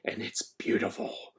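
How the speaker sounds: hushed, breathy whisper of awe; reverent whisper. A narrator's reading. Principal swooning over his new desk, whispering. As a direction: whisper it like a treasure.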